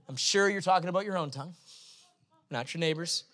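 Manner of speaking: usual speaking voice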